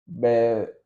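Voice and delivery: ordinary delivery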